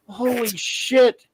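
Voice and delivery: slow, high exclamation; hammed-up amazed reaction; retold stunned disbelief. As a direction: draw it out in mock astonishment. Reliving stunned reaction to audiobook.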